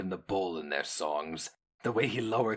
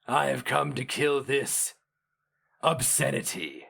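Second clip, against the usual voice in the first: raised, ringing hero voice; bold heroic declaration; 6 semitones higher than usual. Acted. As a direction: declare it boldly, a hero's vow. Hero mage declares his purpose.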